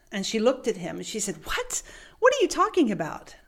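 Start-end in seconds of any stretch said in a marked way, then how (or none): 1.4-3.4 high, quick disbelief; baffled clerk. Retold or imitated. Clerk stunned by her manager's joy, high and fast. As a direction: react in shock.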